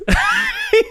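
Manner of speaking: real high laugh. Real, not acted. Telling friends his go-to after winking, high laugh. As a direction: crack up in a short, high burst.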